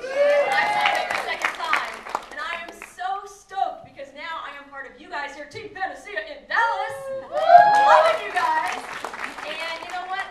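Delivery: yelling out to her team with rising joy; full-voiced cheering, high and loud, stretching the last words; excited shout. Real, not acted. Speaker shouting praise to her team in the crowd, excited.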